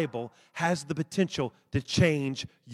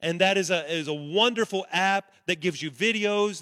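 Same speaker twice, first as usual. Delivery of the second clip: ordinary talk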